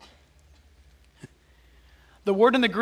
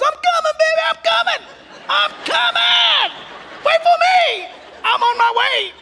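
frantic mother calling; 8 dB louder than usual. Acted. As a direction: holler across a great distance to your baby. Acted penguin mom calling from afar.

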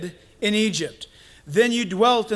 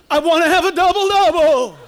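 loud, high, hammed-up preacher voice; absurdly grand proclamation; mock televangelist; 8 semitones higher, 8 dB louder than usual. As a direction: order fast food like a thundering TV preacher. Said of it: "I don't know why people do that".

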